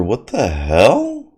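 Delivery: drawn-out, slow what the hell; slow disbelief. Real, not acted. Amazed by the teaser, slow drawn-out disbelief. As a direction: stretch it out in disbelief.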